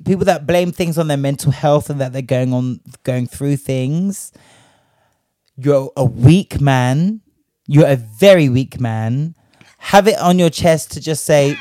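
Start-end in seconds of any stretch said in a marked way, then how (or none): 5.6-9.3 slow scolding. Real, not acted. Scolding the absent man.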